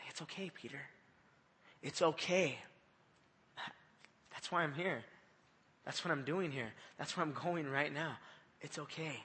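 soft, hushed, reassuring words to a friend; gentle reassurance. Retold or imitated. Jesus softly reassuring Peter, tender and hushed. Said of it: "that look he gave Peter was".

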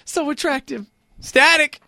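high-pitched gushing voice; mock swooning fan. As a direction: gush in a put-on high voice. Mocks girls who like accent.

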